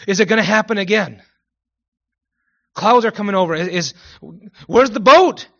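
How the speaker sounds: loud, high freaking-out voice; hammed-up cry of dread; mock panic. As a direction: act out alarm, building to a shout. Acts out Noah's family panicking at clouds.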